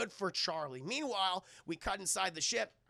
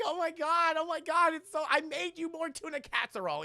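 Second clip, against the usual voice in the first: high, louder gushing; excited neighbor, higher-pitched; 9 semitones higher, 6 dB louder than usual. Retold or imitated. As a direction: gush over him like an eager neighbor. Neighbor greets returning hero.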